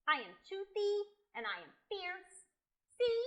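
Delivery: high, emphatic boasting; insistent pretend dragon. Boy proving to dragons he is fierce, high and insistent. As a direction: spell out each fierce quality proudly.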